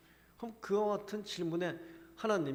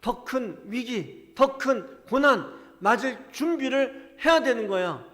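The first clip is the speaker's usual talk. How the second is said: much louder, higher, slow commanding call to be strong; preacher voicing God loudly rousing a weak prophet; God's loud exhortation; 8 semitones higher, 16 dB louder than usual. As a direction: thunder God's command to take courage. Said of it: "하나님 지금 그렇게 우리에게 말씀하고 계시는 거예요".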